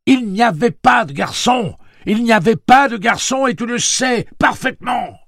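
high, loud, furious denial; réplique criée. A narrator's reading. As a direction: shout back at the other voice, insisting. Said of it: "Une autre voix lui répondit". (démenti crié avec force)

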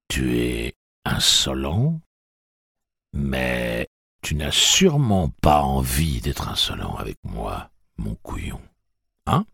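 put-on slow, cold threat to a child; menacing Tic-Tac. A narrator's reading. Tic-Tac threatening the captive boy, slow and menacing. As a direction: lean in and menace him slowly.

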